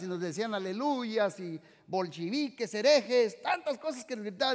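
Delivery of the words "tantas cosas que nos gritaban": normal talking voice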